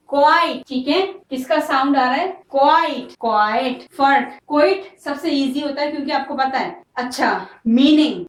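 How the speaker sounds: usual speaking voice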